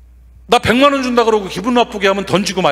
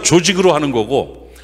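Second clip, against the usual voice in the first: normal talking voice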